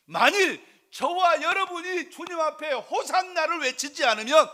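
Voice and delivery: loud, high-pitched exhortation; full-force preaching. Acted. Preacher warning the congregation to shout Hosanna, full force.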